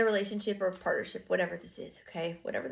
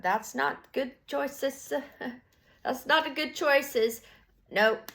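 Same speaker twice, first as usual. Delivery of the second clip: mock scolding; 6 semitones higher, much slower than usual. Slow high judging comment.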